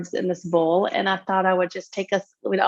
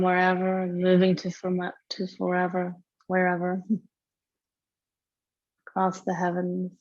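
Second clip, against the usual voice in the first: guided meditation. Real, not acted. Yoga teacher settling students into tonight's practice, guided meditation. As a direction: lead them gently into breath awareness, unhurried.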